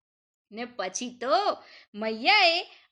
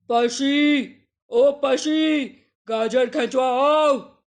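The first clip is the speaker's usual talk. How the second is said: loud, low dog-voiced call; 3 semitones lower, 5 dB louder, slower than usual. A narrator's reading. The dog calls the cat to help, loud low call. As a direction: bellow for the cat to come. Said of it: "બિલાડીને બૂમ પાડી".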